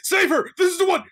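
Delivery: loud excited yelling; shouting. Real, not acted. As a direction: yell it out at the screen. Yelling at the game.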